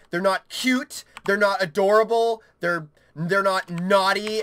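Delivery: ordinary talk, nothing marked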